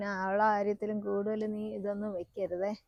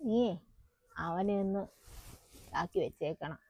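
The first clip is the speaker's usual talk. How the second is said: confiding undertone; 3 semitones lower, 6 dB quieter than usual. Acted. Mother gives secret advice softly.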